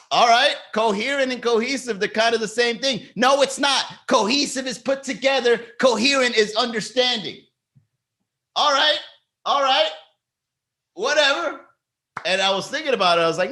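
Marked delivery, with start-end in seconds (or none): 0.1-7.4 high-pitched squabbling over words; mock argument. Host retelling vocabulary argument with comedian, mock squabble. 8.5-11.6 very high, defensive surrender; mock backing down. Host conceding to the heckler, mock backing down. Acted giving up in argument.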